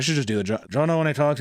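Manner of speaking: normal talking voice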